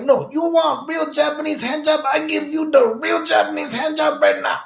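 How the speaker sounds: very high shrieking put-on old lady; old lady shouting. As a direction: yell the pitch like a pushy hustler.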